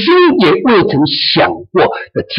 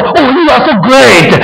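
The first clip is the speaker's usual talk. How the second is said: higher, slow gushing praise; mock admiring; 8 semitones higher, 3 dB louder than usual. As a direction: gush over them as if impressed. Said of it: "他们不会说". (假装夸赞的语气)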